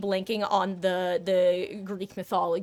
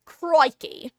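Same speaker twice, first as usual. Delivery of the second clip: higher, louder Australian "Crikey"; 7 semitones higher, 6 dB louder than usual. Pretend. British attempt slipping into a loud Australian accent. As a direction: blurt it as a hammed-up Australian. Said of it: "It just goes Australian".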